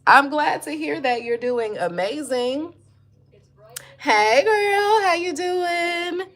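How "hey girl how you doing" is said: high, bright sing-song hello; excited greeting. Real, not acted. Greeting a viewer on the live stream.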